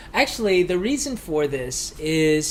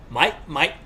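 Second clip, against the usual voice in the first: Aussie mate. Shown as a demonstration. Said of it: "In Australia, it's, like, a lot heavier".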